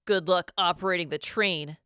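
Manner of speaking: ordinary delivery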